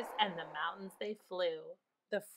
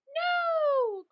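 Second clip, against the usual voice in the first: high, long wail of no; witch's frightened cry; 3 dB louder than usual. A narrator's reading. The witch fleeing the dragon, high frightened cry. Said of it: "cried the witch".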